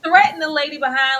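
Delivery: ordinary delivery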